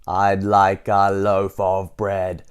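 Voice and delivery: very slow, loud word-by-word roar. A narrator's reading. Mister Noisy losing patience with Missus Crumb, roared. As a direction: boom out each word separately. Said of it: "roared Mister Noisy".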